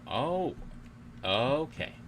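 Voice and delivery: ordinary talk, nothing marked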